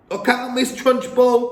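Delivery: put-on high, loud child's warning; cried out. A narrator's reading. Lavender crying a warning to the Trunchbull. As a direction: cry out a warning. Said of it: "cried Lavender".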